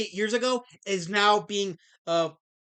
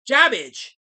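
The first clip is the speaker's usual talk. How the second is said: loud call-out; 6 semitones higher, 11 dB louder than usual. Real, not acted. Streamer calling out a chatter, loud and teasing. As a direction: shout the name Jabbage, louder and higher.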